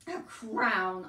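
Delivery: loud, mocking echo; laughing scorn. A narrator's reading. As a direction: repeat it with loud derision. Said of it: "Uncle laughed".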